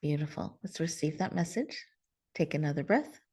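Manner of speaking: hushed and spacious, the host guides listeners inward; guided meditation. Real, not acted. Leads a heart meditation.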